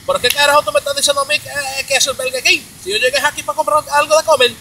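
fast, high, loud Spanish tirade; angry Spanish. Acted. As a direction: fire off a mock-angry rant in Spanish. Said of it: "when you get angry, you want to sound in another language".

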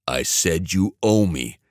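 ordinary delivery, nothing marked

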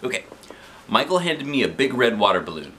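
ordinary delivery

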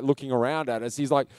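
ordinary delivery

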